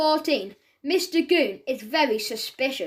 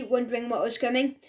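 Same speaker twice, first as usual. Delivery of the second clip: ordinary delivery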